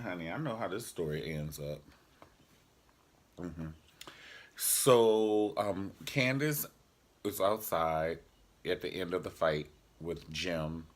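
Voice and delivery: ordinary talk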